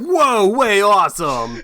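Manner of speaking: slow stoked surfer dude drawl. Pretend. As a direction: stretch it out like a stoned surfer. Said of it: "I make him sound like a fucking surfer".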